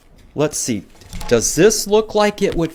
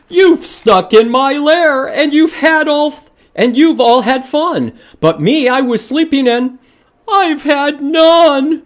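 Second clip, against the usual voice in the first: a lightly voiced, higher, sulky bear; whiny bear; 9 semitones higher, 4 dB louder than usual. Bear complaining to his party guests, lightly whiny. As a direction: whine it like an upset bear.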